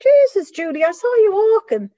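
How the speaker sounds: loud, high Irish complaint; an old Irish man grumbling at her; old Irish guy. Retold or imitated. Old Irish guy tells her she gave him the bird, loud and high in an Irish accent. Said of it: "these old Irish guys would go".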